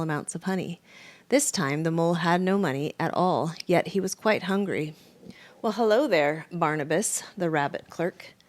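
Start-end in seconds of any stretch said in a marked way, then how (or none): none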